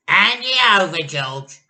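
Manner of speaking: very high, very loud command; snatch it with your voice; Grandma demanding. A narrator's reading. Grandma orders George to hand over the cup.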